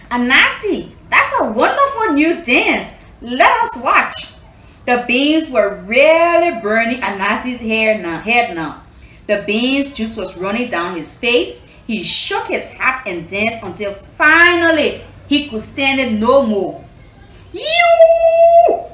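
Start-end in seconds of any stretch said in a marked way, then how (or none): 0.1-4.3 high, loud teasing cheer; excited mocking call. Neighbors teasing Anansi's dance, high and loud. 17.5-18.8 huge high shout; furious shout. Acted. Anansi bursting out at the beans, shouting. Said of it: "Anansi shouted".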